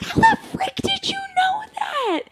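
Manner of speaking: high, half-laughing shocked yell; shocked shriek. Retold or imitated. Woman recalling the stranger who knew her, shocked squeal. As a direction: squeal in disbelief. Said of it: "And I was like".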